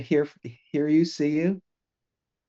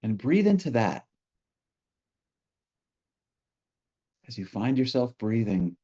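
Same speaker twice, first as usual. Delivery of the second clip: guided meditation; 2 semitones lower, 3 dB quieter than usual. Real, not acted. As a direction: ease listeners gently into stillness, leaving space between phrases.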